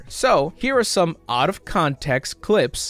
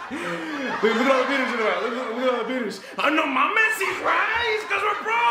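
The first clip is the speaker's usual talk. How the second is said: much higher, hammed-up comic yelling through laughter; Carlos Mencia impression; 10 semitones higher than usual. Reviewer mocks a comic yelling his punchline, high hammed-up cackling delivery. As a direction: crack up and screech the punchline. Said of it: "my best attempt at Mencia".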